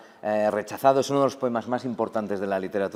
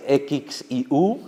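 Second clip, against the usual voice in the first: slow, separated letter names; spelling out; 4 semitones higher, much slower than usual. Shown as a demonstration. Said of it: "deletréame". (deletreando despacio, letra a letra)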